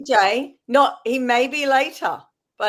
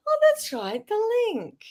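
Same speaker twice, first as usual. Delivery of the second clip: high squeak of remembering; sudden realization; 12 semitones higher, slower than usual. Retold or imitated. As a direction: gasp it out as it hits you.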